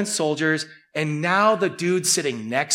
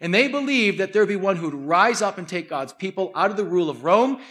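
ordinary talk

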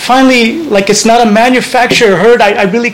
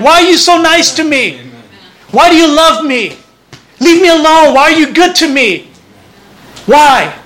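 higher, half-angry pleading at God; almost angry; 6 semitones higher than usual. Retold or imitated. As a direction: protest the kindness as if annoyed. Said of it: "and almost in anger".

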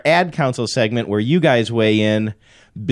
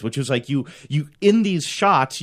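ordinary delivery

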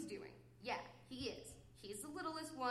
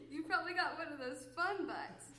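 said through breaking laughter, higher pitched; laughing; 4 semitones higher, 3 dB louder than usual. Real, not acted. Teasing a little guy about being fun, laughing. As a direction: crack up while saying it. Cracking up at own joke.

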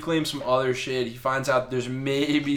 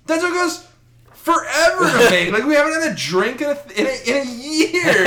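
high, laughing mock dwarf whine; whiny dwarves; 12 semitones higher, 6 dB louder than usual. Dwarves complain to Thor, high and laughing. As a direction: squeak it out like a put-upon dwarf, half laughing. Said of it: "they're like".

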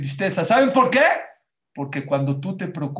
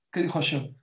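ordinary talk, nothing marked

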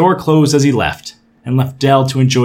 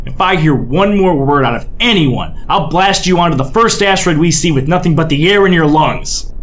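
angry captain; 6 semitones higher, 4 dB louder than usual. Acted. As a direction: lay down the law furiously. Angry captain orders crew.

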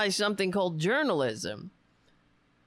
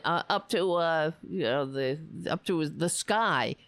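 normal talking voice

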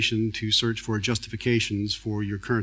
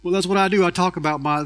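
high, loud, exaggerated Southern drawl; put-on Southern drawl; 4 semitones higher, 3 dB louder than usual. Speaker greets the audience in a hammed-up Southern accent. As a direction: ham up a thick Southern accent to tease the crowd. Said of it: "that's not the, the actual accent".

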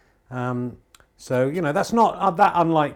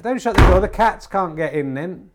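calling out; 5 semitones higher, 7 dB louder than usual. Real, not acted. Calling to wife at door.

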